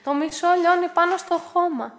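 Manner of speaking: grumbling. A narrator's reading. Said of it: "γκρίνιαξε η Άννα".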